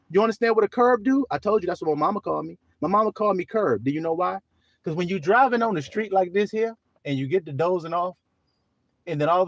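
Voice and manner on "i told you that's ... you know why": ordinary talk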